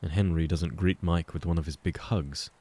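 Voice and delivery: plain reading voice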